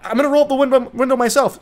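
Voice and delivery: high-pitched mock exasperated mother; fed-up mom. Mom to her bratty daughter in the car, mock fed-up. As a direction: imitate a mom taking over.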